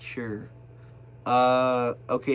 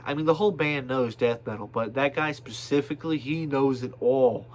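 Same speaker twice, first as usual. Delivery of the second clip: ordinary talk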